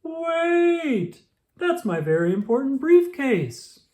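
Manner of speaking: put-on high loud bellow after the children. A narrator's reading. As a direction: bellow across the street at the bus. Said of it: "bellowed Mister Brown".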